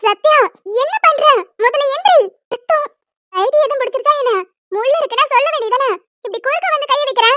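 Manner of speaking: high, loud alarmed cries; startled scolding at the sight of blood; alarmed shout. Acted. Woman shouting in alarm at the man who blocked the thorns. Sees blood on his hand.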